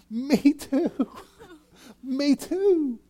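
high, chuckling me too; laughing agreement. Acted. Preacher acting a friend who shares the same struggle, laughing. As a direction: laugh along in sympathy. Mock reply to complaining friend.

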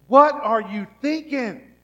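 high, loud and slow, stretched out in disbelief; exasperated shout. Retold or imitated. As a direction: cry it out at God in frustration. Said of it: "You've ever thought".

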